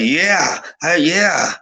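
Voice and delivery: high, drawn-out yeahs; imitated enthusiastic agreement. Imitating the girl who was into it, high enthusiastic yeahs. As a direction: cheer along, pitch climbing.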